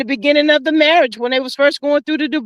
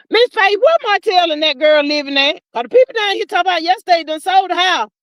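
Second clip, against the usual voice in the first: ordinary talk, nothing marked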